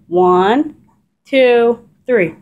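loud, bright counting cue; counting the dog into a trick. Real, not acted.

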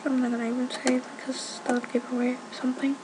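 secretive whisper. Real, not acted. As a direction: hush it, keep it secret. Keeping a secret from viewers.

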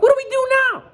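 very high and loud question; Duck asking. Acted. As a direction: ask it like an eager duck. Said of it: "asked Duck".

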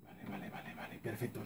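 breathy near-silent vale; whispered. Real, not acted. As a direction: whisper it to yourself. Whispering while looting. (susurro casi sin voz)

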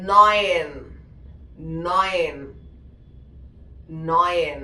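"നയൻ" as German neun, with an oy vowel; "നയൻ" said firmly as noyn, repeated for learners; German pronunciation demo. Shown as a demonstration. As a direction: drill "നയൻ" as NOY-en, falling each time. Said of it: "നയൻ നമ്മൾ പറയാ".

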